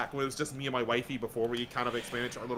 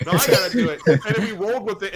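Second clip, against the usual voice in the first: laughing; 5 semitones higher, 12 dB louder than usual. Real, not acted. Laughing while telling dad's prank.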